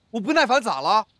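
high-pitched testy comeback; irritated, challenging retort; impatient. Acted. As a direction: snap back defiantly.

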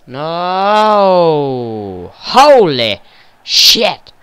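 loud, high cry of dismay at the goal; shocked outburst. Real, not acted. Gamer yelling at conceding a goal, shocked. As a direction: yell it as the ball hits the net.

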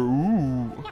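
ordinary delivery

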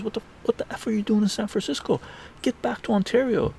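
very high, incredulous mock outrage; retold mentor ordering him back; high-pitched, flustered scolding; exasperated outburst.

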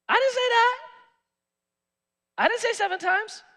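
preacher voicing Jesus, high and emphatic; Jesus answering. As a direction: rise up high and insist it's not seven times. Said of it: "Jesus said to him".

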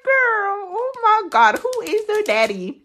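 high fake-crying voice; mock dramatic wail. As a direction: wail it like a hammed-up soap opera. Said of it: "And I was like".

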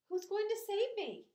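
high, loud, urgent question; cried out. A narrator's reading. Pig asking the spider for a rescuer, cried out. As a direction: call it out desperately. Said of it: "cried Wilbur".

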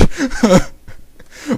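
high, loud burst of laughter; real high laugh. Real, not acted. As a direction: break up after the overacting. Cracking up at own acting.

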